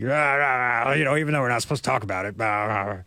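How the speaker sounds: gravelly, garbled broadcaster mumble; Romo's gravelly voice. Retold or imitated. Host mocking the commentator's on-air slip, gravelly rah-rah impression. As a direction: rasp and jabber through it like an old commentator. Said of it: "That gravelly voice that he has now".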